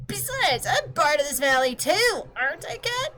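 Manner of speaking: put-on high, fast, offended kid voice; indignant girl. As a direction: snap back at the pompous clock, pitched high. Girl character argues back.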